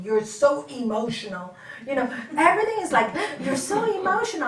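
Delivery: loud and fast; mock husband's complaint. Husband retorting to his wife, loud and fast. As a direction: throw his words back, rising. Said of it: "he used to say to me".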